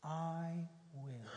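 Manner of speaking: low, soft "I will"; gently reassuring reply; tender assurance. Retold or imitated. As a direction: answer with quiet compassion. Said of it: "what does Jesus say".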